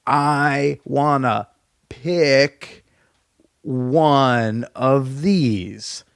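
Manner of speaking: very slow, spelled-out words for a foreigner; exaggeratedly slow pantomime speech; over-slow explaining. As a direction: drag each word out so she understands. Miming to non-English-speaking staff.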